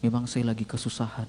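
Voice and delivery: soft, low voice of hardship; tearful confession, understated. Retold or imitated. Young man confessing hardship to the pastor, subdued and sad. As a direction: confide it quietly, retelling his sorrow. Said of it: "Ketika dia menangis".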